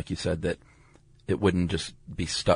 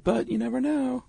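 mock coy voice jumping very high; coy hopeful quote. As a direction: lightly imitate a group still clinging to hope, pitched way up. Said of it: "But they still said".

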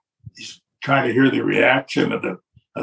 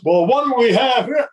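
cocky challenge; 10 semitones higher, 3 dB louder than usual. Retold or imitated. Vince sizing up newcomer, voiced.

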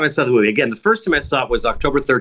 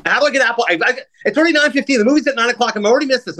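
high, frantic plea for help at the counter; hammed-up flustered customer begging the ushers; mock panic; 9 semitones higher, faster than usual. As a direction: retell the panic, pitched up and pleading. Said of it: "I'm panicking".

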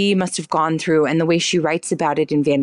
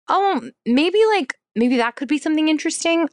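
higher-pitched retold musing; dawning idea; 10 semitones higher than usual. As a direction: float it like a fresh idea. Recalling her own tentative new idea.

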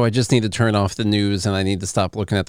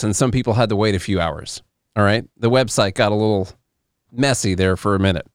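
ordinary talk

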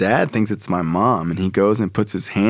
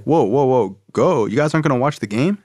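higher, quickening mock-dad protest; taken-aback dad; 4 semitones higher than usual. Dad to Carl and son leaving, surprised. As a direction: halt them in disbelief. Dad stunned they would leave.

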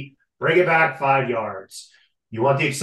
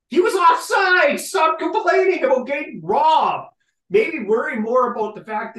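loud, much higher scolding of the fans; scolding shout; 11 semitones higher, 4 dB louder than usual. Real, not acted. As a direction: yell at the fans to stop whining.

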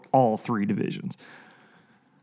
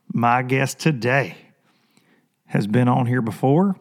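ordinary talk, nothing marked

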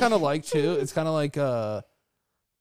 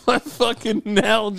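mock anguished wail; 6 semitones higher than usual. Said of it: "screaming for it like fucking, uh, Tom Hanks".

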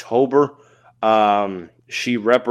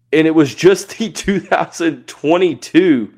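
laughing; 5 semitones higher, 5 dB louder than usual. Real, not acted. Laughing at her long accolades.

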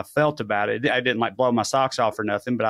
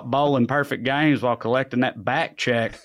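ordinary delivery

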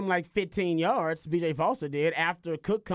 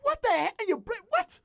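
exasperated disbelief; 13 semitones higher than usual. Real, not acted. Host baffled by the coach's blitz call, outraged high-pitched disbelief.

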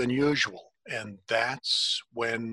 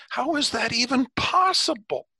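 astonished; 12 semitones higher, 3 dB louder than usual. Acted. Amazed at unburnt bush.

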